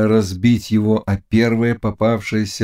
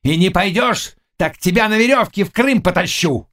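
high-pitched, furious threat; roared threat; 11 semitones higher than usual. A narrator's reading. Tatar threatens to drag Khmelnitsky to Crimea, roaring. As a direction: threaten him at the top of your range. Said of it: "заревел Тугайбей".

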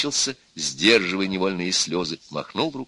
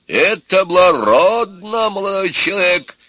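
exclaiming; 11 semitones higher, 8 dB louder than usual. A narrator's reading. Grandfather approving of the young suitor, loud exclamation. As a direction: proclaim it grandly, stretching the words. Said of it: "воскликнул дед".

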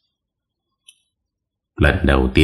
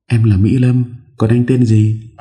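put-on young woman voice; 7 semitones higher than usual. A narrator's reading. Young woman character speaks.

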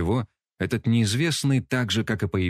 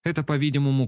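ordinary delivery, nothing marked

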